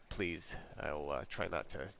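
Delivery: usual speaking voice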